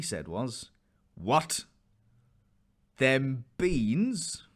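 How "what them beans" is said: louder, higher disbelief; dumbfounded at the beans; flabbergasted. A narrator's reading. Mother seeing the beans, flabbergasted. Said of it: "his mother's turn to be flabbergasted".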